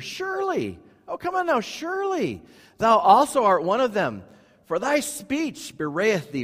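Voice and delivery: high, drawn-out mock accusation; accusing bystander. Bystanders accusing Peter in the courtyard, high and taunting. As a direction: lean into the taunting surely.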